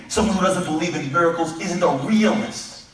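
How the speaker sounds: normal talking voice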